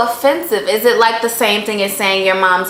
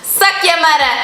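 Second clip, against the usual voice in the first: higher attempt at Jamaican "Suck your mother"; learner's shaky Jamaican accent, pitched up; trying a Jamaican accent; 7 semitones higher than usual. Shown as a demonstration. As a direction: test the accent, a bit higher. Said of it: "Is my accent right?".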